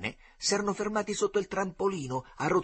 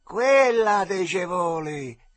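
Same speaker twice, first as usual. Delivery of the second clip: loud, drawn-out, raised-pitch jeer; shouting; 6 semitones higher, 10 dB louder, much slower than usual. A narrator's reading. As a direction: yell it slowly across a river. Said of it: "gridò il Caciotta".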